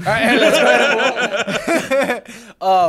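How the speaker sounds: laughing loudly while moving on. Real, not acted. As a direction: laugh through the words. Laughing after three men agree.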